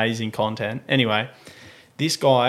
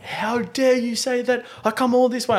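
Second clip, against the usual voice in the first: mock aggressive; 9 semitones higher than usual. Buyer scolding the seller after a long trip, mock outrage. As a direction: imitate an affronted buyer, pitched up. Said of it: "This guy's getting real aggressive".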